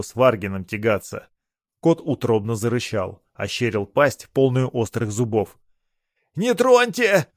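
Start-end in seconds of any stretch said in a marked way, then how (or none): 6.3-7.3 high, loud desperate plea; pleading. A narrator's reading. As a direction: beg for mercy. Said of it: "взмолился банник".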